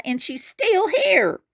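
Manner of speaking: high squeaky little cub; tiny bear finds his bed taken; Baby Bear crying. Acted. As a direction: pitch way up, small and upset. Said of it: "he cried".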